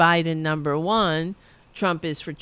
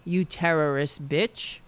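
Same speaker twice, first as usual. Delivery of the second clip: ordinary talk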